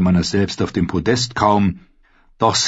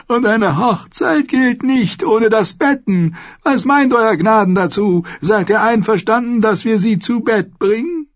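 put-on leering old lord; 12 semitones higher than usual. A narrator's reading. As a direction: relish every crude word like a lecherous old host. Old lord continues his speech.

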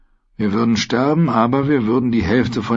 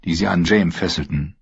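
ordinary delivery

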